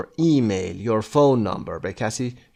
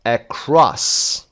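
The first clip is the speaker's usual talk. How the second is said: Across with CROSS punched; short light start, strong second syllable in Across; stress on second syllable; 2 semitones higher, 4 dB louder than usual. Shown as a demonstration. Teacher working through the underlining exercise, second-syllable stress on Across. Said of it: "قسمت دوم".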